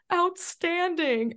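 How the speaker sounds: high, slow sarcastic outstanding. Retold or imitated. Answering her boyfriend filming her crying on the hike, high slow sarcasm. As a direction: chirp it up high and slow while falling apart. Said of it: "And I'm like".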